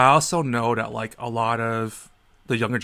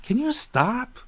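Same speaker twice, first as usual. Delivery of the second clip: exasperated; 11 semitones higher, slower than usual. Retold or imitated. Own exasperated reply.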